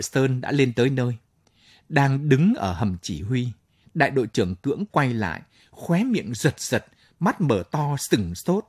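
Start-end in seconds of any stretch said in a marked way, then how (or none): none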